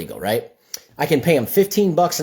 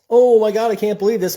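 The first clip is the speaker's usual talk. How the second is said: higher, louder mock gasp of disbelief; mock outrage; 7 semitones higher, 4 dB louder than usual. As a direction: play the shocked public, hammed-up. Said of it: "everyone's so mad about this".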